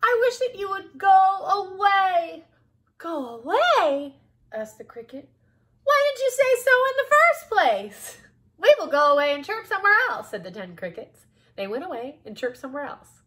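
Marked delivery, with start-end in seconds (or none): 0.0-2.4 high, loud mouse pleading; loud, high-pitched begging; shouted plea. A narrator's reading. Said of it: "shouted the mouse". 3.0-4.2 loud, slow, higher question; high cricket voice, querying; surprised cricket. A narrator's reading. Cricket finally understands. 5.8-10.3 loud, high cricket voice; high-pitched crickets, speeding up at the end; obliging crickets. A narrator's reading.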